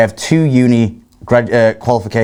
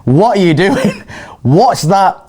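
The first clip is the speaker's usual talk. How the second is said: shocked mum; 6 semitones higher, slower than usual. Retold or imitated. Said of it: "she's like".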